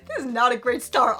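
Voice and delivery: talking loudly through laughter; real embarrassed laughter. Real, not acted. Vlogger laughing at a dark start to the Q and A, amused. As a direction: laugh helplessly while getting the words out.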